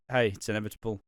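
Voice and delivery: ordinary delivery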